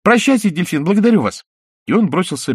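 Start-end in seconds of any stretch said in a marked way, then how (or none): 0.0-1.4 put-on high, eager puppet boy; wooden boy voice. A narrator's reading. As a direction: pipe it like a small boy. Pinocchio fleeing.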